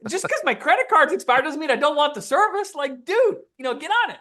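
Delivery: high, lively indignant rant; vent, half laughing at yourself; mock rant. Ranting at the exterminator.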